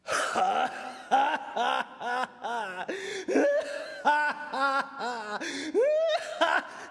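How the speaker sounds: guffawing on and on; loud long cackling. Acted. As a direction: burst into a long fit of laughter. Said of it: "何をゲラゲラ荒れてんねん". (ゲラゲラと長く笑い続ける)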